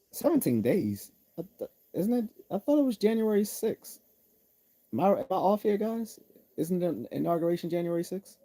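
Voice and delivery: high, incredulous squeak of disbelief; mock confused. Mock confusion over the date.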